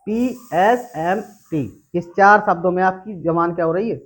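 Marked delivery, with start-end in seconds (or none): 0.1-1.9 each letter drawn out for students; slow letter-by-letter. Shown as a demonstration. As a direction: spell out P S M T slowly, one by one. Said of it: "यानी". (धीरे-धीरे अक्षर-अक्षर बोलें)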